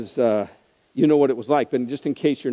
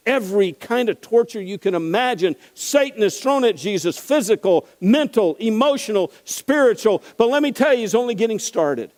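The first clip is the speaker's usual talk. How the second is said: rising preaching fervor; 8 semitones higher than usual. Acted. Preacher stirring the congregation toward a climax. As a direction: preach it up to a peak.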